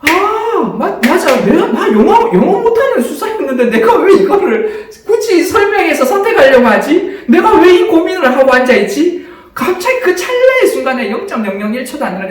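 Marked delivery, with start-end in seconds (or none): 0.0-9.2 high, loud laughing self-talk; laughing realization. Retold or imitated. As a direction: laugh at yourself in sudden insight. (높고 크게 웃으며 깨닫는 혼잣말)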